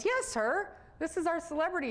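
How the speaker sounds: excited doctor. Retold or imitated. Doctor telling the girl she's the celebrity, excited and high. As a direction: sell it like she's a star.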